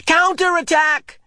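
high, loud, drawn-out battle cry; shout. A narrator's reading. Opposing child shouting back across the field. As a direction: call the counterattack across the field. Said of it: "came an opposing shout".

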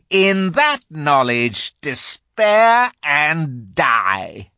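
gloating witch, rising to a high, slow roar; triumphant evil queen, building to fury; quivering witch voice. A narrator's reading. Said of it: "said in a quivering voice".

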